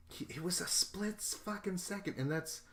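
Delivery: real astonishment; shocked excitement. Real, not acted. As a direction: squeak it out in disbelief.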